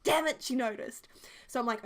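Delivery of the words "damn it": mock frustrated muttered curse, higher; half-joking damn it under pressure. Frustrated quoted outburst.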